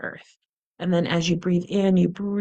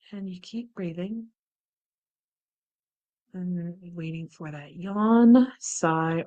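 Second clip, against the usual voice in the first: guided meditation; 9 dB quieter than usual. Acted. Guided relaxation practice.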